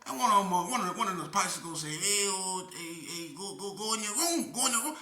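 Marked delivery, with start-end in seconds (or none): 1.9-5.0 high sing-song voiced inmate; bicycle shouting orders. Inmate telling others to go to their rooms, high and bossy.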